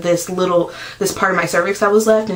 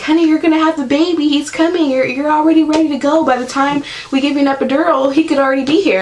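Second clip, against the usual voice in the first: higher, fast, sweet nurse impression; 7 semitones higher, faster than usual. Nurse telling the mother it's too late for an epidural, fast and higher. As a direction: hurry through like a brisk kind nurse. Nurse voiced explaining urgency.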